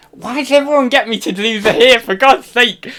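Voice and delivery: high, loud exasperated complaint. Real, not acted. Fed up with impression requests.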